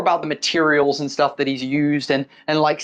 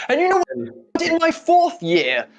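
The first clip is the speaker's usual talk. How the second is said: pompous architect, higher and slow; a proud old man savouring his past; Leopold reminiscing; 4 semitones higher than usual. Acted. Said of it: "as he goes".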